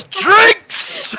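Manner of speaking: high, drawn-out call for drinks; crowd's cheer. Acted. Whole bar answering Rodney's call, high cheering shout. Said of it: "everybody in the bar goes".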